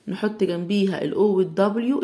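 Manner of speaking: ordinary talk, nothing marked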